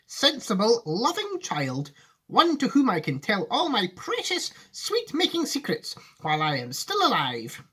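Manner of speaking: high, heartfelt Wonka voice; an old sweet-maker confiding his plan; earnest Wonka. Acted. Wonka explaining to Charlie why he needs a child, high.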